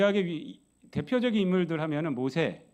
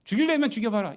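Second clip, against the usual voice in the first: louder, higher mock dare; bold defiance; 7 semitones higher, 7 dB louder, faster than usual. Preacher voices Elijah's imagined bold faith, defiant. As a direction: imitate a fearless challenge to the enemy. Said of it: "더 담대한 믿음으로".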